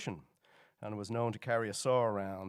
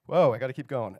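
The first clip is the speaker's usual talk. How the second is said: startled rush; 9 dB louder than usual. Real, not acted. Rushing as slides advance.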